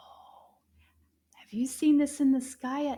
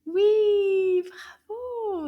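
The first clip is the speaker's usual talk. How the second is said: excited praise; 7 semitones higher, 7 dB louder, much slower than usual. Real, not acted.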